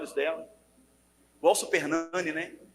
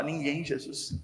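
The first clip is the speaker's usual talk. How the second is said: faint reply; 4 semitones lower, 10 dB quieter, slower than usual. Retold or imitated. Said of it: "Ela fala".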